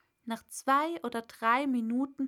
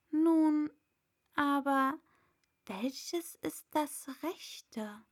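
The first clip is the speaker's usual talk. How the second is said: musing Alice; 4 semitones higher, slower than usual. Acted. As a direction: puzzle it out to herself. Alice to herself.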